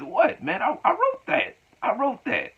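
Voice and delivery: high indignant mock voice insisting he wrote it; squeaky mock protest. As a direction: squeak it out like a caught liar.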